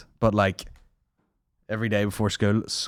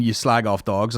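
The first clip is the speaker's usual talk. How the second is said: normal talking voice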